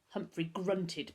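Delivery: ordinary delivery, nothing marked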